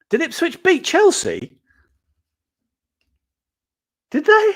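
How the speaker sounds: high, loud disbelieving question; real surprise. Real, not acted. Vlogger spotting the Ipswich score, loud astonished disbelief. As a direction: gasp out the news in disbelief. Checking football score mid-video.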